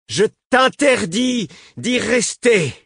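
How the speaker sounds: hurlement lent, très aigu, martelé; mots détachés, autorité de reine. A narrator's reading. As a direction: crier l'interdiction en détachant chaque mot. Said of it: "Elle prononça ces derniers mots en les détachant". (ordre hurlé, mots détachés)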